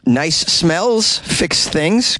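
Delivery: very slow, high, sing-song mock sincerity; mock sincere. Mocking the article's claim, slow sing-song mock sincerity. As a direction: drag it out like a dopey slogan. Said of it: "The article goes on to say".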